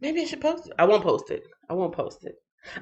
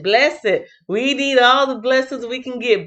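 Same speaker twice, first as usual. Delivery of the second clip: usual speaking voice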